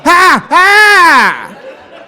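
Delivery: loud, high fake laugh; bellowed awkward two-beat laugh; caveman laugh. Relatives unused to the camera, loud caveman laugh. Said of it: "caveman delay".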